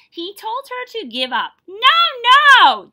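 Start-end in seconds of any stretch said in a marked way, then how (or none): 1.7-2.9 yelled. A narrator's reading. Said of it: "Trudy yelled over the sound".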